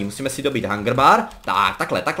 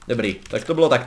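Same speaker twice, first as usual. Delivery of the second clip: ordinary delivery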